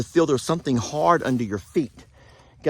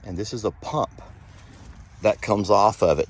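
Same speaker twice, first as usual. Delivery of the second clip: ordinary delivery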